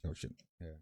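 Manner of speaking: ordinary talk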